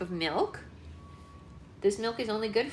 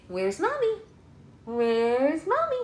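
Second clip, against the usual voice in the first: peekaboo singsong; 7 semitones higher, slower than usual. Pretend. Peekaboo game with baby.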